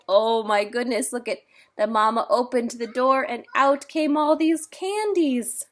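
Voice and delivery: usual speaking voice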